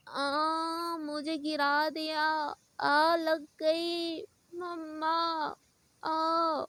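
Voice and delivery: slow, whiny put-on child's wail; fake crying child. Little girl on the floor after the push, dramatically fake crying. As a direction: drag out the fake crying to guilt her. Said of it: "ऐसे वो बोलने लगती है".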